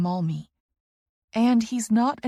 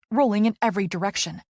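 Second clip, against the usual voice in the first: ordinary delivery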